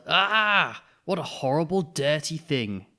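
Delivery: high, loud disgusted cry, dragging out dirty thing slowly; yelled in disgust. Acted. Parents yelling at the dirty Bunny, loud and disgusted. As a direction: recoil and yell at the filthy toy. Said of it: "They yelled".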